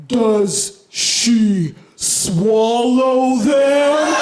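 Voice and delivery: put-on child's question stretched out in extreme slow motion. Comic retelling of a toddler's question, in slow motion. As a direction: drag every word like a slowed-down replay. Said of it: "I heard it in slow motion".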